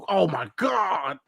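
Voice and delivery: slow, breathless awe; awed. Retold or imitated. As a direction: let it out in disbelief. Awed at sketch.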